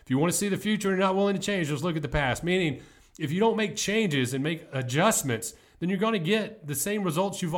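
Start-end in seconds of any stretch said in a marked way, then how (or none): 0.0-2.4 fast, higher retelling of the advice; quick, eager repeat of the mentor's words; repeated faster. Retold or imitated. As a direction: rattle the line off quickly, pitched up. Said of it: "That's what he said".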